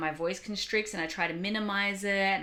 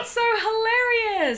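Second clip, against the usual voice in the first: laughing; 8 dB louder than usual. Real, not acted. Laughing at story.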